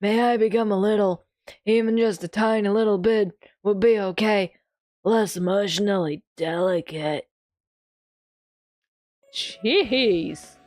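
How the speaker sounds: put-on timid old teacher's small wish; lightly voiced meek old man. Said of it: "he's still getting the old man voice".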